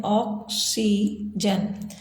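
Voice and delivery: ऑक्सीजन very slowly in three parts, ऑक, सी, जन; split into syllables. Shown as a demonstration. Teacher letting pupils hear three sounds, ऑक्सीजन slowly split. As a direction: pause between each syllable of ऑक्सीजन, drawing them out. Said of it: "तीन ध्वनियों को सुन पाते हैं".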